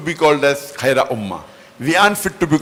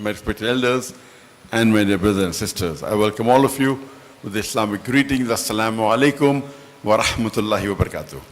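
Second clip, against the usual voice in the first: ordinary talk, nothing marked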